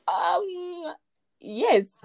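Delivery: a high, teary, laughing yes; high, emotional, laughing yes; overwhelmed yes. Retold or imitated. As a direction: choke out yes half crying, half laughing. Retold acceptance of song offer.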